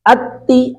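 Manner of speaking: teacher demonstrating shadda on اتی; blended syllable. Shown as a demonstration. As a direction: join ات and تی into اتی. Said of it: "تا جو ہے وہ ایک مرتبہ حمزہ کے ساتھ".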